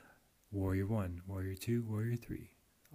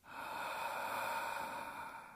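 open-mouth throat-constricted exhale; ocean throat breathing; 5 dB quieter than usual. Shown as a demonstration. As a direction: breathe out like fogging a mirror. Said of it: "So if I had my mouth open, it sounds like".

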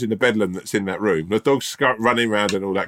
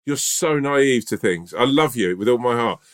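slow mock-earnest; slower than usual. Mock-earnest lecture to friend.

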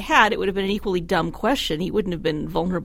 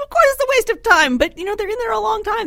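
exclamatory; 13 semitones higher, 5 dB louder than usual. Real, not acted. Lively amused commentary.

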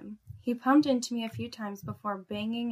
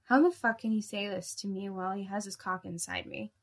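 ordinary delivery

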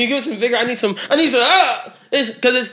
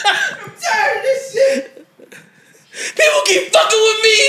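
high-pitched laughing wail; laughing mock cry; 10 semitones higher than usual. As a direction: fake sobbing through laughter. Mock breakdown while laughing.

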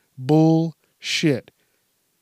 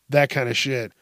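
ordinary talk

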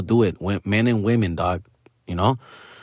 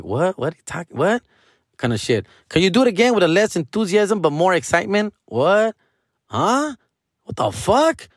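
baffled, rising higher and higher; mock confusion; 6 semitones higher than usual. To the acting teacher, bewildered and rising. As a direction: play dumb, more lost with every question. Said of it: "I'm just like".

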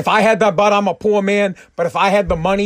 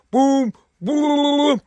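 imitated phone ringing; 7 semitones higher than usual. Calling China on the bat line, high imitated ringing. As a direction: mimic a hotline ringing in a high voice.

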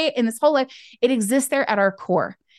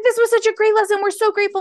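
imitated gushing, pitched way up; gushing gratitude; 11 semitones higher, faster than usual. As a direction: mimic effusive thanks in a high voice. Imitating grateful adults praising her lesson.

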